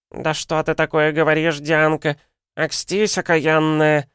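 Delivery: put-on high, affronted old woman; Галина Никитична. A narrator's reading. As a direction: rebuke the younger woman indignantly. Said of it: "говорила Галина Никитична". (возмущённая старуха, высоким голосом)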